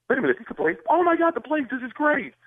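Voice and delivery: high, thrilled fan squeal; imitating starstruck fans, pitch shooting way up; excited fans. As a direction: gush like fans going crazy. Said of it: "people are just going crazy".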